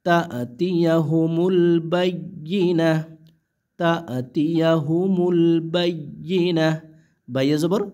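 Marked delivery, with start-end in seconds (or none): 0.6-2.9 slow, drawn-out recited phrase; slow tajweed reading; slow recitation. Shown as a demonstration. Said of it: "এরকম উচ্চারণ হবে কিন্তু". 3.8-6.7 slow, drawn-out recited phrase; slow tajweed reading; slow recitation. Shown as a demonstration. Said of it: "এরকম উচ্চারণ হবে".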